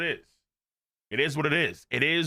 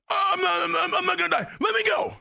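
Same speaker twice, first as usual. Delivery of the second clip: high-pitched stammering wail; hammed-up panicky cry; desperate protest; 13 semitones higher than usual. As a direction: yelp it, struggling to get free. Acts out Luffy struggling.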